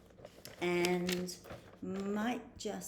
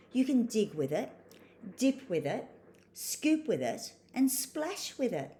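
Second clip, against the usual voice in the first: expressive, lilting picture-book read, higher on dialogue; story narration for children; 4 semitones higher, 5 dB louder than usual. A narrator's reading. Reading a spoonbill story to children, animated storytelling. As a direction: read to little ones with big emphasis on key words.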